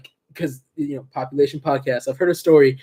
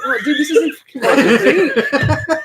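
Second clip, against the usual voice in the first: laughing shout; 11 semitones higher, slower than usual. Real, not acted. Mock outrage amid laughter.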